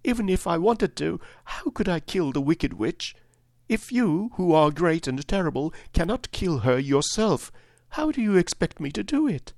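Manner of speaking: put-on very high, distressed little-girl voice; sobbing, acted. Weeping girl questions great and terrible Oz, acted tearful plea. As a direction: plead tearfully, building to a high final question. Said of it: "she sobbed".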